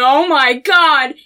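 loud, drawn-out exclamation; amazed outcry. Retold or imitated. Reacting to the painting, acted.

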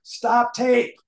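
yelling to stop the taping; urgent shout. Retold or imitated. Urgent call during taping.

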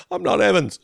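high-pitched mock protest; imitated cartoon protest. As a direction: mimic the swallowed man's indignant cry, high. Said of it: "just like".

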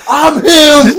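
high triumphant yell; hype shout. Acted. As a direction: shout like a star. Imagined celebration.

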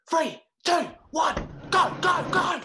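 high shouted countdown; shouting countdown. Acted. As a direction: yell the count to launch them. Countdown before bursting in.

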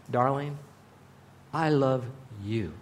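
soft, tender "Darling, I love you" fading low; loving whisper to his wife. Shown as a demonstration. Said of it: "Now she knows".

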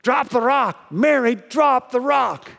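high, loud shout from the boat; yelling. Acted. Everybody on the boat yells to sinking Mary, loud high shout. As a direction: yell it across the water, slow and urgent. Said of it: "everybody on the boat yells".

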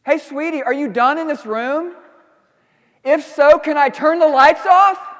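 fake-sweet question hiding irritation; passive-aggressive. Husband asking his wife about the lights, passive-aggressive sweet. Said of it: "passive-aggressive question".

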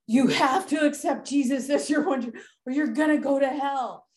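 lightly voiced scared child begging her dad; pleading child. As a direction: beg dad like a scared seven-year-old. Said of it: "And I'm like".

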